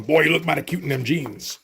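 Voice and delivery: a light Eddie Murphy Mister T impression; Eddie Murphy doing Mister T. Reviewer imagining Eddie Murphy's Mister T, a light impression. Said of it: "Eddie Murphy going".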